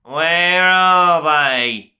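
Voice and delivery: slow, loud furious yell. A narrator's reading. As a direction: bellow it across the corridor. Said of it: "he yelled".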